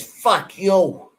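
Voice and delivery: imitating Bill Reed telling someone off; mock Bill Reed. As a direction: bark it like a crusty local. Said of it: "Bill Reed says".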